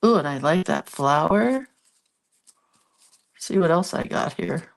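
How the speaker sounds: stuffy nose from allergies; congested. Real, not acted. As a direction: talk through a congested nose. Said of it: "I'm so sorry for the sniffling".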